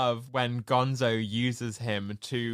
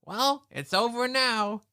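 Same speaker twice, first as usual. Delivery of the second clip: Gonzo impression; 9 semitones higher than usual. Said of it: "And Gonzo says".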